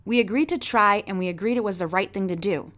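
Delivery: usual speaking voice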